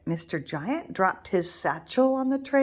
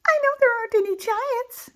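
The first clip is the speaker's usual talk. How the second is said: much higher, nervous little wolf voice; 5 dB louder than usual. Acted. Little wolf talks himself brave on the path, nervous. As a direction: reassure yourself out loud, scared. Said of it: "he said aloud".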